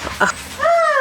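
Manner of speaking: very high squeal of laughter; real high-pitched delighted giggle. Real, not acted. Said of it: "how I'm reacting and my laugh".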